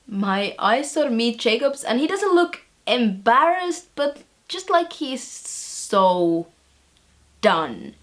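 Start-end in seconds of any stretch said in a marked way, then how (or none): none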